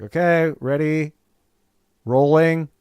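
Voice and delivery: high, loud call to the crew; calling out on set. Retold or imitated. Actor telling the film crew he's ready, loud and high. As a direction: sing it out like you're ready for the take. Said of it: "I'd be like".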